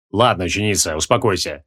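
calm the pupil in a low, weary tone; mildly sad, low voice; downcast. A narrator's reading. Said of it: "грустно опустил плечи Креол".

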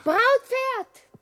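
high, loud summons; calling out. Acted. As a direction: shout for the horse. Calling the bridal steed.

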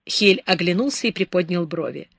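ordinary delivery, nothing marked

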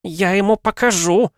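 high, loud spiteful threat; a wife's high angry vow. A narrator's reading. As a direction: spit it out in fury at the husband. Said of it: "зло произнесла Лариса".

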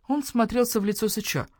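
plain reading voice

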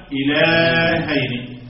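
"إلهين" chanted very slowly, long held vowel; drawn-out chant on "إلهين"; verse recitation. Shown as a demonstration. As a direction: stretch the middle of "إلهين" as in recitation. Recites the word within its verse.

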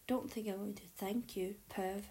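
plain reading voice

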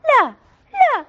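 loud, high, slow furious no; angry yell. A narrator's reading. Girl refusing to cut the tree, angry yell. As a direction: yell it out in anger. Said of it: "صرخت فتاة بغضب".

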